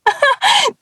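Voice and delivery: high, startled little laugh; nervous laugh. Retold or imitated. Nervous laugh at being picked.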